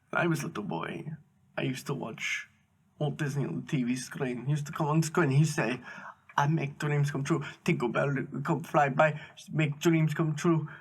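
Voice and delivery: halting, choked-up imitation in a foreign accent; holding back tears. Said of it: "trying to hold back tears".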